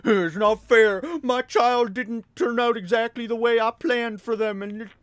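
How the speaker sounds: mock a self-pitying parent; disappointed parent. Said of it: "you see parents do this all the time".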